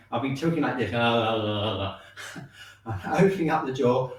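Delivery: jaw hanging loose. Real, not acted. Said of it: "I can't talk at the same time".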